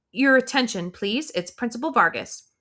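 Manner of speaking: a principal on the PA, tense and high; stressed principal. Acted. Principal announcing over the PA, high and tense. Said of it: "Her voice seems higher pitched than usual and a little shrill".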